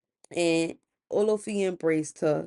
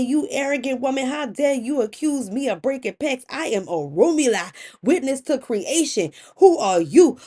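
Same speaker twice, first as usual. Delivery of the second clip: higher-pitched, offended husband demanding answers; proud, wounded authority hitting back; indignant accusation; 6 semitones higher, 4 dB louder than usual. Acted. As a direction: act out an outraged rebuke, building to a loud challenge. Acted quarrel, offended husband accuses wife.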